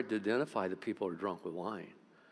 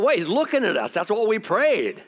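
excited realization; 10 semitones higher, 9 dB louder than usual. Retold or imitated. Realizes the prayer is answered.

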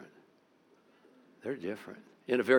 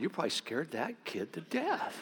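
laughing; 6 semitones higher, 7 dB quieter than usual. Retold or imitated.

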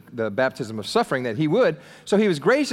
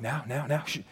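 soft hushing; 2 semitones lower, 9 dB quieter than usual. Retold or imitated. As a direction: quietly shush the others. James and John hushing the others.

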